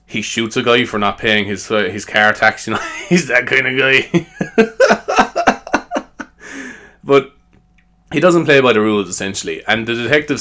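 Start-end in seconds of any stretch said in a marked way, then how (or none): none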